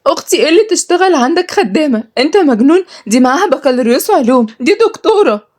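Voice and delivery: high-pitched tearful outrage; crying. Acted. Nada defends sister against servant offer, crying. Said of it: "ردت ندى بعياط".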